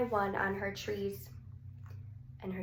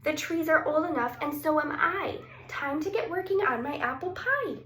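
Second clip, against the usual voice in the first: put-on higher, eager little girl voice; keen to start baking; 7 semitones higher, 4 dB louder than usual. A narrator's reading. Sophie speaks eagerly.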